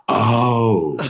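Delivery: mild drawn-out "oh" as it sinks in; acted slow penny-drop; dawning realization. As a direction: let understanding land, lightly played. Boy finally understands the word.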